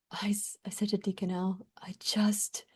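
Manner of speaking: hushed, breathy confession; whispered, barely voiced. Real, not acted. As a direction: drop to a whisper. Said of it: "whispered admission".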